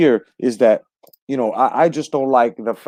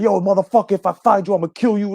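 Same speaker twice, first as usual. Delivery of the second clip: higher-pitched, hammed-up street threat; mock American threat; 8 semitones higher than usual. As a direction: act out a tough guy's threat, pitched high. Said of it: "like us here in America".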